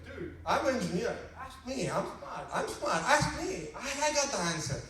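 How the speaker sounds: excited know-it-all pleading; eager volunteering. Acted. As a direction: beg to be picked to answer.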